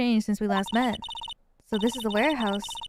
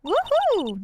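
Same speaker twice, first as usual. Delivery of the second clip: excited cheer; 12 semitones higher, 3 dB louder than usual. Acted. Cheering at praise.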